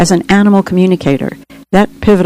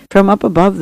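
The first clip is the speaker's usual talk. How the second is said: usual speaking voice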